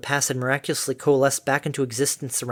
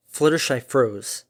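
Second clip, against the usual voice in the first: plain reading voice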